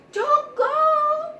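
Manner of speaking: very high, loud mouse squeal; frantic squeak. A narrator's reading. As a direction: squeak it out in alarm. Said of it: "squeaked her friend".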